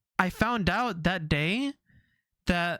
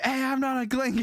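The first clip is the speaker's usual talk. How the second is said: mock yell back; 7 semitones higher than usual. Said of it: "he yells back at them".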